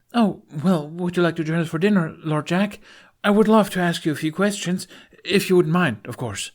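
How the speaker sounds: high, fast, eager question rush. Acted. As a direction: gush out the invitation excitedly. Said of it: "eyes sparkling".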